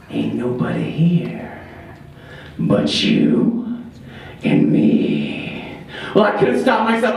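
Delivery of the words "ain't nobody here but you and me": slow, eerie whisper; menacing whisper. Acted. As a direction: whisper it like a mountain ghoul. Stranger's menacing words.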